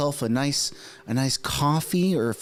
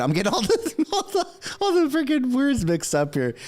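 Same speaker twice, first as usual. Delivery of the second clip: laughing, much higher; laughing at himself; 11 semitones higher than usual. Real, not acted. A host to his viewers, laughing at his own mix-up. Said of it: "Holy crap, man".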